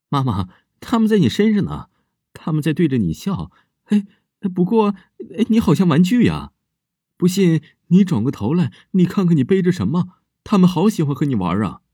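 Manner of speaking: put-on high, loud childlike voice, gleeful and eerie; a child happily telling you ghosts sit on your back; creepy little girl. Girl tells stepmother the ghosts are on her, gleeful high child voice.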